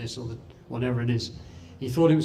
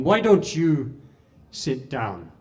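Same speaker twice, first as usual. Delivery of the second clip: retold call; 2 semitones higher, 7 dB louder, slower than usual. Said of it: "a voice rang out".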